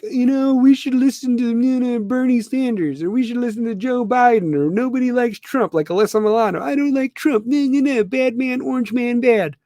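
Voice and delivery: high, preachy celebrity voice mocking their politics; high sing-song mock of a famous activist. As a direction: parody a smug star lecturing fans, pitched high. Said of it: "And they're like".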